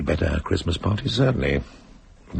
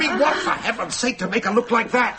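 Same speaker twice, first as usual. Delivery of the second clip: high alarmed voice, nearly breaking. Acted. Husband sees Rachel's contorted face, high alarmed cry. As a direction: recoil at what you see on her face.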